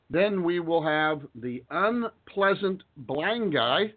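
unpleasant blind guy said very slowly, word by word; slow teaching demo of a nickname; deliberately slow. Shown as a demonstration. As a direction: spell it out slowly so they learn how to say it. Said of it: "I'm saying it that way so that the exceptional conservative can learn how to say it".

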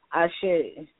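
ordinary talk

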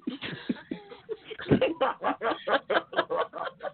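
high rising laugh, breathless; can't stop laughing at the clip; real laughing fit rising high; long high laugh. Real, not acted. Laughs hard at replayed clip.

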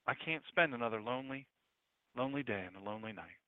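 soft, sinking, desolate voice; lonely lament. Acted. A hurting believer facing lonely nights, quietly desolate. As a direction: let the plea fade into acted loneliness.

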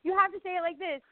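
high insistent mock director; director's demand. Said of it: "they were like".

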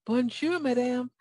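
practicing French. Retold or imitated. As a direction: lilt it up like a learner practicing French. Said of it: "I was practicing my French".

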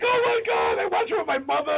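very high-pitched fan imitation; mock starstruck gushing; excited fan. Lady recognizing him, retold.

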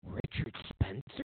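usual speaking voice

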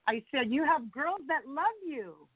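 ordinary delivery

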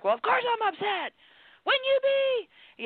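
playing an upset person who snaps back in a high, drawn-out voice; indignant upset person. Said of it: "the person is like".

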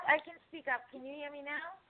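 ordinary talk, nothing marked

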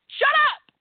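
sudden high, loud snap in a put-on voice; angry shout. Fed up with trolls, a loud put-on shout. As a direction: cut them off sharply. Annoyed rant at Twitter trolls.

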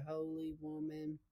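normal talking voice

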